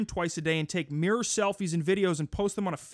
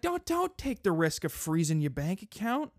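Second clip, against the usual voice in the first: normal talking voice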